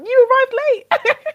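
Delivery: high, loud, hammed-up telling-off; high-pitched comic outburst of disbelief; mock exasperation. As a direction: act fed up with a late passenger. Acts exasperated reply to late passengers.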